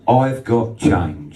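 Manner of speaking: slowed, level punchline; slow deadpan reply. Retold or imitated. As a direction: land it slow and dry. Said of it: "what she said".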